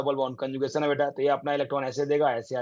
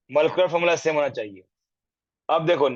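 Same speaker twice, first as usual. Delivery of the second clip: usual speaking voice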